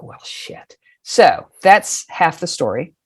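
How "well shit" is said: very soft, near-whispered retold reaction; sheepish realization under the breath; deflated aside. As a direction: drop to a tiny, unvoiced admission. Deflated reaction after being corrected.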